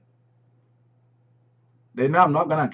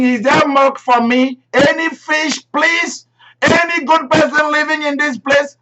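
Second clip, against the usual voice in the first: much higher, sing-song little beggar cat; pleading cat voice; 13 semitones higher, 6 dB louder than usual. Pretend. Preacher playing a stray cat begging at the door, high and pleading. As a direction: ask sweetly for milk like a hungry stray.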